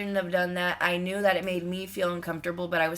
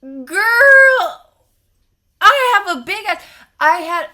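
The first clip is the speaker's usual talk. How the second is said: much higher, much louder outburst; exasperated yell; 11 semitones higher, 12 dB louder, slower than usual. Real, not acted. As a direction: explode in disbelief at the listener. Fuming about only eighty dollars.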